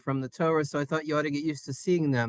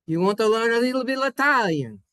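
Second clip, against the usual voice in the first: higher, hammed-up Italian accent; put-on Italian accent; 7 semitones higher, 4 dB louder than usual. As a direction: pitch it up and ham up the Italian. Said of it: "don't say it with that accent".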